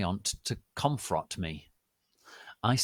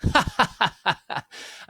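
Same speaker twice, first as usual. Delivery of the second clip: high breathy cackle; unvoiced mocking laugh; 11 semitones higher, 8 dB louder than usual. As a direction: cackle at them. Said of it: "Dumbledore started to cockle".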